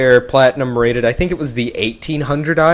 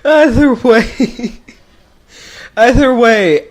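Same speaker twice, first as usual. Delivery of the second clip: laughing; 9 semitones higher, slower than usual. Real, not acted. Laughing after accent bit.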